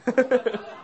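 high giggling laugh; amused laugh. Real, not acted. Speaker joking to audience about rent prices, high amused laugh. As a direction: laugh at your own joke, pitched high.

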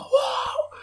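very high mock whoa from the crowd; one guy's whoa. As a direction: squeal it like one guy in the audience. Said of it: "that one guy go".

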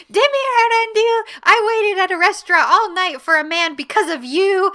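high, loud mock scolding; full-name telling-off, hammed up; imitated yelling. Drunk woman berates him over restaurant wait, imitated yelling. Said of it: "she's yelling at him".

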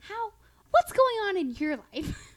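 high, eager question; high-pitched impression of an inquisitive mom; imitated nosy sweet mom. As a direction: ask with gushing interest, pitched high. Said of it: "She's like".

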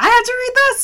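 high, loud outraged squawk; mock angry. Cohost replaying her annoyance at reading, mock angry. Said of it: "that's why I was angry".

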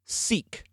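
"seek" breathed out without voice, set apart after a pause; unvoiced "seek", a reverent hush after a long pause; whispered seek. Shown as a demonstration. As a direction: let "seek" land as a hushed, airy word. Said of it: "And the word is".

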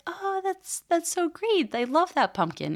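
very high, sing-song over-the-top praise; gushing mom praise. Retold or imitated. Mom gushing in a very high voice over her son's craft. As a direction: gush like a mom faking delight at a craft.

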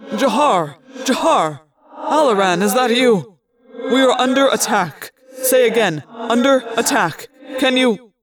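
captain calling out under attack; urgent distress call. A narrator's reading. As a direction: call for help at full urgency.

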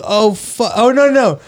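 sudden high panicky "oh no"; alarmed dismay. Retold or imitated. Retelling the moment he realized, in alarmed dismay. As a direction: yelp it as the mistake dawns.

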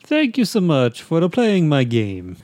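high, slow game-mascot voice; cartoon Italian game character; Mario. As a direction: imitate a cheerful video game plumber, drawn out. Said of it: "The voice of Mario".

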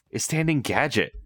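usual speaking voice

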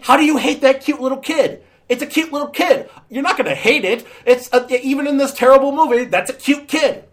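high-pitched, pleading indignation; comic outraged squeal; incredulous protest. Acted. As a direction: defend it as if nobody could disagree. Arguing in defence of cute kid.

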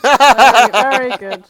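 very loud, laughing through the words; loud laughing praise. Real, not acted. Host loudly cheering a co-host's pun, laughing. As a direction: belt out the praise while cracking up.

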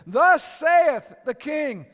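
slow, loud, final herald's edict; final royal decree. Retold or imitated. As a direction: proclaim it with no room to argue. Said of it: "a sense of finality".

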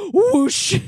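loud, drawn-out whoosh. Retold or imitated. Describing hair catching on fire, drawn-out whoosh. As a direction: imitate flames suddenly going up.

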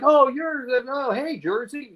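light up as the name comes to you; retold recognition. Dealer suddenly recognizes the visitor from town gossip, surprised greeting.

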